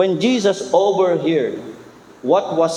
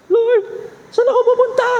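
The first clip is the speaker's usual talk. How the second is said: much higher, desperate cry to the Lord; panicked plea. Retold or imitated. Worried believer buried in bills, desperate high cry. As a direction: cry out like a cornered debtor. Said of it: "Tapos ikaw ngayon".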